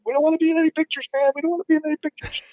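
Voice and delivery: high, quick retold pleading; imitated hurried, high-pitched objection; mock alarmed protest. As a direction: rush it out high, imitating men backing off. Retold panicked guys fleeing camera.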